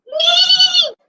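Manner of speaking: high, held imitated whinny; horse neigh. Shown as a demonstration. Storyteller quizzes children on the horse, neighing high. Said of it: "horse who does".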